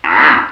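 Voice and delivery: a loud, sudden tiger growl Ah. A narrator's reading. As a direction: roar Ah like a tiger at a picture. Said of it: "he growled at the tiger in the picture".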